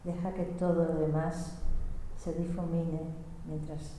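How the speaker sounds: very soft, hushed meditation voice; guided meditation. Real, not acted. Interpreter letting all else fade away, meditative. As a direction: let the voice fade with the phrases.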